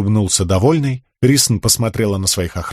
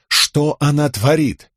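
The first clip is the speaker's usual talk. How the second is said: ordinary delivery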